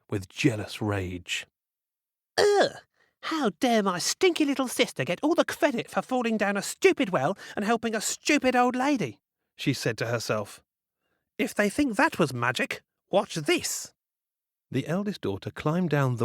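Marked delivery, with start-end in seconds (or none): 2.4-2.7 loud, high disgusted groan; a bratty groan of disgust; disgusted ugh. Acted. Jealous sister's outburst. 3.2-9.2 high, whiny jealous rant; a spoiled girl seething with envy; bratty jealous sister. Acted. Jealous eldest sister ranting. 11.4-13.9 high, smug spoiled voice; plotting, sure of herself; bratty scheming girl. Acted. Eldest sister scheming.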